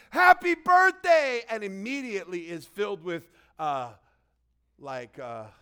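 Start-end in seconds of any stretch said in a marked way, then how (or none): 0.1-1.4 high, loud and drawn out; scream. Acted. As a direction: shout the greeting with glee. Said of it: "who hears".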